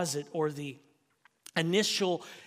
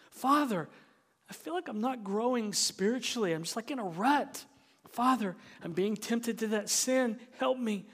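mildly pleading prayer, pitched higher; crying out; 5 semitones higher than usual. Acted. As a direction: call on God for help, voice lifted. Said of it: "To cry out and say something like".

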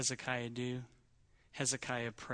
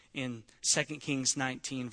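ordinary talk, nothing marked

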